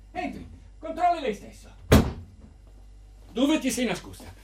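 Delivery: ordinary talk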